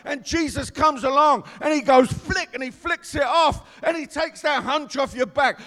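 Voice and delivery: loud, rising preacher's call; full-force preaching. Acted. Preacher to the congregation, full-force preaching.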